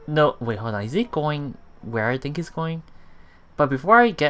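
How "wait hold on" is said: ordinary talk, nothing marked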